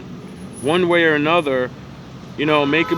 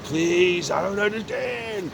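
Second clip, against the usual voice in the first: soft, rising mock-tearful plea; imitated tearful pleading, trailing softer; retold desperate plea; 3 semitones higher, 5 dB quieter, slower than usual. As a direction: act out begging through tears, voice climbing. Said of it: "crying, desperate tears of the Lord".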